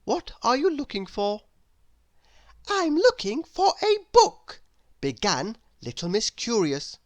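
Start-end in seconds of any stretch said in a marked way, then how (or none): 0.1-1.4 a higher, polite librarian voice; helpful and kind. Acted. Missus Page answers Miss Curious politely. Character voice. 2.7-4.6 put-on a curious little girl asking sweetly; little Miss Curious voice. Narrator voices Miss Curious asking her door. Character voice.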